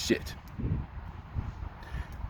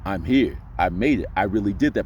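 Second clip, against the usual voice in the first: much lower and settled; deep relaxed voice; 10 semitones lower, 3 dB quieter than usual. Shown as a demonstration. Coach showing the relaxed, confident voice. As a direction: let it drop deep and easy. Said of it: "y'all saw that progression".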